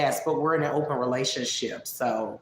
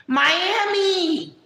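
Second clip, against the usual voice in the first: high, drawn-out mock yell; high impression voice, stretched and falling; Jessica; 12 semitones higher, 4 dB louder than usual. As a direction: call out a name in a loud caricature. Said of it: "Imitate Jessica Messica".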